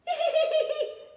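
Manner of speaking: high, loud squealing laugh; pig's loud laugh. Acted. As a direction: squeal with laughter in his ear. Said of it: "Pig went up to Dog and went".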